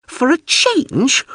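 slow, high, wounded drone repeat; with feeling. Acted. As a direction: stretch it out, genuinely hurt. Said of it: "with what sounded like feeling".

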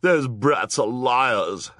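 loud, high, drawn-out snap; snapping. A narrator's reading. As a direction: snap it with slow, stretched words. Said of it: "Esme snapped".

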